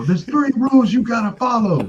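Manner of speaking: high, loud, drawn-out coach speech; Michael Irvin. Acted. Coach slapping somebody on entry, hammed-up loud command. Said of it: "Like Michael Irvin".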